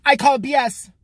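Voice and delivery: loud, high mock protest speech; mocking Emma. Mocking the girl at the microphone, loud and high. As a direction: ham up a fiery rally speech. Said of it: "She's like".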